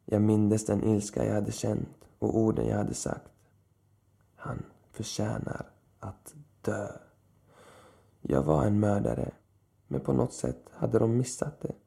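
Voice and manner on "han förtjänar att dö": cold murmur. A narrator's reading. Recalled words of rage.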